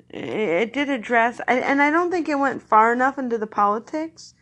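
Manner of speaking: usual speaking voice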